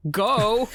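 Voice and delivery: "Go." barked high and loud as a one-word order; emphatic go. Retold or imitated. Imitating the landlord telling Peter to go, emphatic. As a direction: hit "Go." hard, like talking to an idiot. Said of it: "He says it so emphatically".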